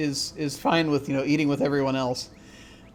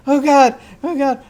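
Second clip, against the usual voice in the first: high, loud acted panic; hammed-up dread of catching a fever; panicked crowd; 10 semitones higher, 9 dB louder than usual. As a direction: cry out in alarm, pitched way up.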